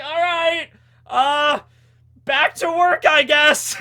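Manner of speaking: high, loud cartoon villain voice; acting out Caesar. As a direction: shrug it off in a squeaky villain voice. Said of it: "I'm like".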